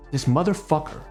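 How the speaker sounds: usual speaking voice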